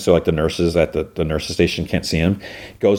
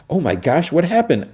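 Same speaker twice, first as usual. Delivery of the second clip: shocked gasp; 11 semitones higher than usual. Retold or imitated. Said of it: "she gasps".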